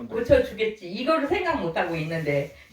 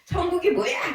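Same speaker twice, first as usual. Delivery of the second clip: mock shout; 6 semitones higher than usual. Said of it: "소리를 지르시고".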